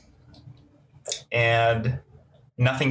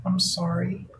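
Chelsea's voice; 5 semitones higher, 3 dB quieter than usual. Acted. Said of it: "Chelsea is kind of like".

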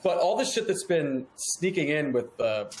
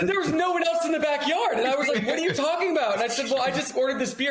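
higher, incredulous retelling; mock disbelief; 10 semitones higher than usual. Retelling how the bartender threw him out, incredulous and high. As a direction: replay the baffled protest, pitched up. Retelling being asked to leave.

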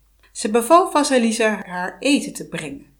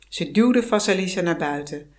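plain reading voice

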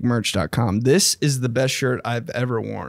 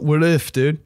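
normal talking voice

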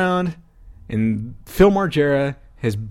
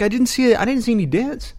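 much higher, defensive disbelief; incredulous protest; 10 semitones higher than usual. Retold or imitated. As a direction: argue you saw no damage. Protesting damage claim.

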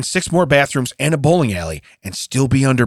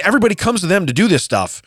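ordinary talk

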